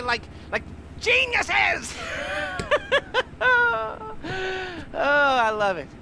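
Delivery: high, shouted words melting into a laughing sob; ecstatic praise. Acted. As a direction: cry out in overjoyed admiration. Gushing praise, near tears.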